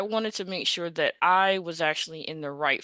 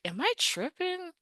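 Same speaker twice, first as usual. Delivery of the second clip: disbelief; 11 semitones higher, 4 dB quieter than usual. Retold or imitated. Disbelief after job rescinded.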